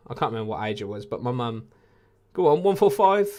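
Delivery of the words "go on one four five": high, loud cheer at the score; loud go-on shout at a big score; excited cheer. Real, not acted. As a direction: urge the dart on.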